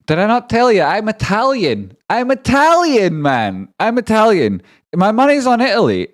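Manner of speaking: high, loud crowing about being Italian; excited boasting. Real, not acted. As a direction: shout it proudly, drawing out the long vowels. Gloating excitedly about supporting Italy.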